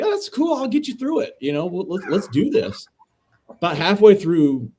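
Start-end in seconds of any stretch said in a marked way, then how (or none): 0.0-2.9 high-pitched, easygoing mock comforting; retold reassurance. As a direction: start way up high, settle into a breezy pep talk.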